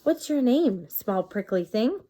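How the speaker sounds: ordinary delivery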